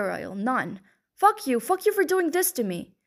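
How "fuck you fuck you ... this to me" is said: sudden high, furious cry, much higher; furious outburst. Acted. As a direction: explode at him, fed up with the pain. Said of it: "The anger just came like a wave".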